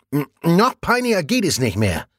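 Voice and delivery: outraged while still chewing; indignant, mouth full. A narrator's reading. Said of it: "mit vollen Backen". (empört mit vollem Mund)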